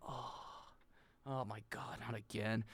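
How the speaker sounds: low, hushed exasperated groan; weary sigh. Acted. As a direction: sigh it out softly, dreading it. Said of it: "She sighs when she walks".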